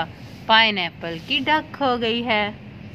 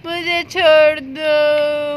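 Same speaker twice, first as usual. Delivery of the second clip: mock cry for help; 2 semitones higher, 5 dB louder, much slower than usual. Duck cries out to cat.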